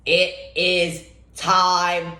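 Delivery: slow, drawn-out announcer intro; hype intro. Acted. Vlogger opening a game seven preview, slow hype announcer voice. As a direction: stretch each phrase like a big-game announcer.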